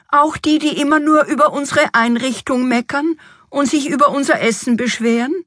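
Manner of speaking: high, peevish complaint; grumpy queen. A narrator's reading. Queen objects to inviting critical relatives, grumpy. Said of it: "erkundigte sich missmutig die Königin".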